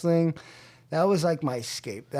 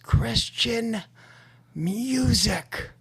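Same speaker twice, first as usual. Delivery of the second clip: imitating mom's scream; 8 semitones higher, much slower than usual. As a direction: stretch each word out in a high, held-back imitation of screaming. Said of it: "screamed at the top of her lungs".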